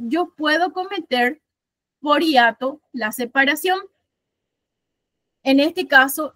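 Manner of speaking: ordinary delivery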